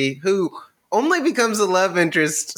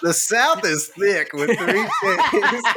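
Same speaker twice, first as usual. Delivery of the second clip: laughing; 6 semitones higher, slower than usual. Acted. Joking punchline with laugh.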